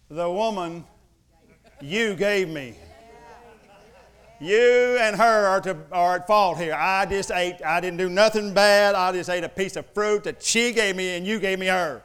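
loud, high protesting excuse; Adam loudly blaming the woman before God; defensive blaming. Retold or imitated. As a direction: shout your innocence and push the blame away.

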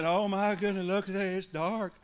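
hand-wringing, trailing off; a touch of worried whining; mock fretting. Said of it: "You never hear God doing that".

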